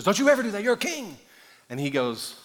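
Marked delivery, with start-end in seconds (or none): 0.0-1.2 high-pitched wifely reprimand; acted scolding. Wife correcting King David, scolding. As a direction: correct him with mock indignation. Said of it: "his wife corrects him".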